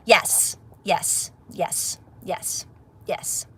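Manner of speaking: fading whisper, yes, yes, dying away; whispered yeses. Real, not acted. To viewers agreeing with her, whispered yeses fading to silence. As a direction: breathe the yeses out until nearly nothing.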